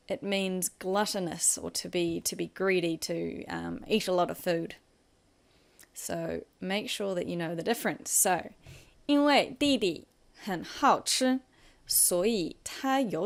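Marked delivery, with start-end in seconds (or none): none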